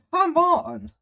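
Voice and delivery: high, exasperated whine; whiny come on. Real, not acted. Reactor whining at the crying girl, exasperated. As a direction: whine it in disbelief.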